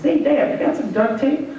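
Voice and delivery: J R. Acted. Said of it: "he's got a son named J R".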